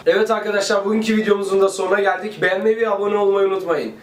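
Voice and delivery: bright host sign-off; vlog outro. Acted. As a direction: wrap up for viewers. Channel outro.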